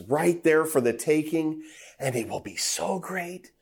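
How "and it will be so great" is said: tempting hush. Acted. Satan's tempting promise.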